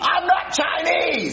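high, drawn-out protest; exasperated yell. Acted. Accused man denying it to interrogator, exasperated yell. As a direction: stretch out the denial in exasperation.

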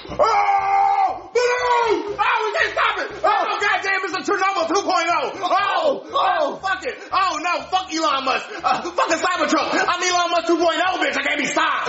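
high frantic yelling at the eruption; wild shouting. Acted. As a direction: holler like it's the apocalypse.

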